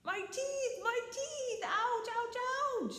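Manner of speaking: high howling whine; howling in pain. Acted. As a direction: howl over broken teeth. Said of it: "howled Coyote". (Coyote aúlla muy agudo de dolor)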